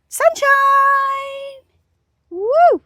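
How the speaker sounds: high, loud cheerful shout; excited calling. Real, not acted. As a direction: whoop out into the sunshine.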